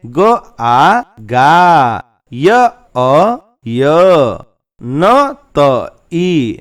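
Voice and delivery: put-on very slow syllable drill for children; slow letter-by-letter spelling. Shown as a demonstration. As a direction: sound out each letter slowly, one by one. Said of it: "अब अगला अभ्यास है".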